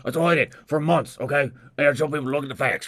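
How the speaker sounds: loud, high defensive rant; indignant ranting impression; Alex Jones. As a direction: protest at full volume like a conspiracy host. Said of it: "Alex Jones goes nowhere".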